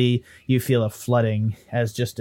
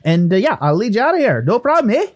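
higher, insistent Irish-Canadian voice; pleading ghost Willie; 10 semitones higher, 4 dB louder than usual. Acted. Ghost bargaining for a way out, insistent. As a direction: wheedle in a lilting mixed accent.

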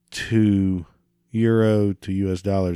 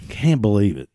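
ordinary talk, nothing marked

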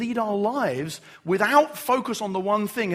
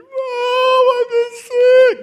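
put-on much higher, louder and slow, a child's wail; a little one crying out from down the hall; sick child calling; 14 dB louder, much slower than usual. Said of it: "a voice down the hall just going".

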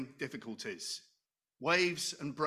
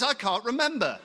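high and louder, laughing it out; sheepish soul; 7 semitones higher, 6 dB louder than usual. Acted. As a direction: answer like a forgetful soul, chuckling. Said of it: "And my soul says".

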